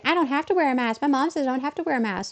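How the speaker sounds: mock child whine; imitating a child. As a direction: mimic a defiant little kid. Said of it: "little tiny people who are like".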